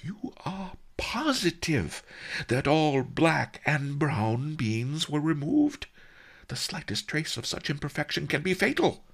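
high, hushed then quickening worry; anxious merchant. A narrator's reading. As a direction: question him fearfully, speeding into the warning. Anxious merchant interrogates Master Li.

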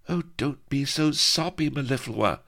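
high teasing maiden's voice; girlish scolding. Acted. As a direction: tease her friend, light and high. Vanity's teasing reply.

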